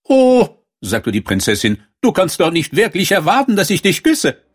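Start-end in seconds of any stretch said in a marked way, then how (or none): 0.0-0.6 high, loud "oh"; princess character. A narrator's reading. Princess reminded of her promise, high loud exclamation. 2.0-4.4 high-pitched, dismissive princess; haughty princess. A narrator's reading. Princess refusing to kiss a frog, haughty and high.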